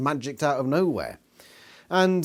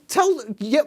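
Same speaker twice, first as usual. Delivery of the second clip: ordinary talk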